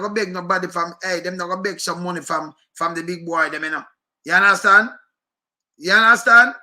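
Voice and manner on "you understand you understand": loud, pressing the question home; loud demand. Real, not acted. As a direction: demand an answer from the room. Rhetorical challenge to listeners.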